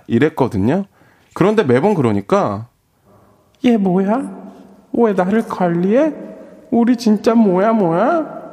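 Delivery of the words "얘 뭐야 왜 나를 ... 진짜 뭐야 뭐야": put-on high, slow puzzled girl; girl's voice. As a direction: wonder aloud in a girl's voice. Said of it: "여자 목소리를 낼 때".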